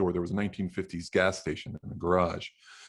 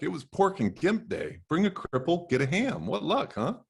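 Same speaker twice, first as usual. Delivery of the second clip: sarcastic joke; 10 semitones higher than usual. Acted. Narrator jokes bitterly to Jack.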